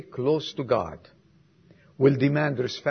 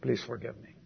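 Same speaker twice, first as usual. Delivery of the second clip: humble apology; 6 semitones lower, 10 dB quieter than usual. Retold or imitated. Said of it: "to say".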